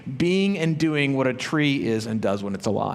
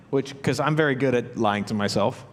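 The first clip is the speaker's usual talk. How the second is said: ordinary delivery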